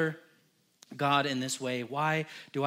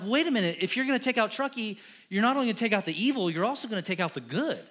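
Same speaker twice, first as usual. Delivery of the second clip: higher, louder urgent bargaining with God; urgent plea; 6 semitones higher, 3 dB louder, faster than usual. Acted. Pastor pleads with the Lord to spare Truckee, urgently. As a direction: talk him out of it, fast and pressing.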